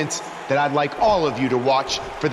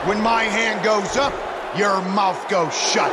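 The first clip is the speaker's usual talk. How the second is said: commanding shout; 3 semitones higher, slower than usual. Acted. Host silencing the crowd at his show, slow commanding shout.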